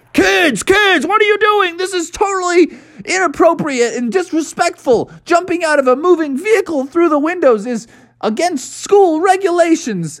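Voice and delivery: high, shouted scolding bus driver; a bus driver scolding runaway kids. Acted. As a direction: yell at the kids like a frazzled driver.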